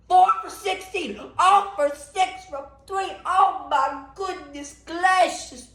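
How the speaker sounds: high-pitched, drawn-out disbelief; appalled outburst. Real, not acted. Fan appalled at James Harden's shooting, exasperated wail. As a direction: wail in exasperation, then run out of words.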